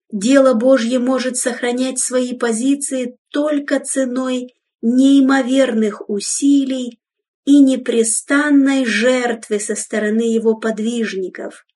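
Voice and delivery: normal talking voice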